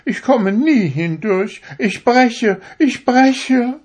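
high, loud panicked wail; needle crying out. A narrator's reading. The needle pushed into the slipper, wailing high and loud. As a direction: cry out as the needle snaps. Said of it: "sagte die Stopfnadel".

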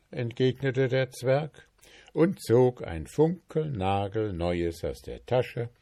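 ordinary delivery

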